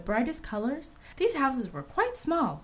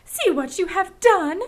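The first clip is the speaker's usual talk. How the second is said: high, loud scolding; angry milkmaid; 7 semitones higher, 5 dB louder than usual. A narrator's reading. Milkmaid scolding strangers over her broken cow, angrily. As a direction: berate them in an indignant, raised voice. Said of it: "cried the milkmaid angrily".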